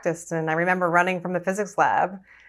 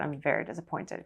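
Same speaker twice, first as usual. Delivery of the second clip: a hint of a Russian accent, dropping softer; 2 semitones lower, 10 dB quieter than usual. Ballet teacher scolding her for quitting, soft mock Russian accent. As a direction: mimic the Russian teacher's accent, quiet and disapproving. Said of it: "in her Russian accent".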